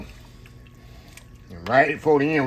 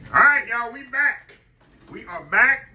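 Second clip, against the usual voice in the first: excited return; 12 semitones higher, 6 dB louder than usual. Real, not acted. Returning after the break.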